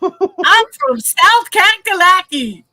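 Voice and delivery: loud, high South Carolina put-on accent. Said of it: "Your turn, [name]".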